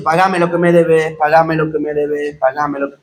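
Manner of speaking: mock being strangled while demanding payment, fading out; choking voice. Preacher acting the servant choked while demanding his debt, strangled fading voice. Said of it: "Por eso dice ahogaba".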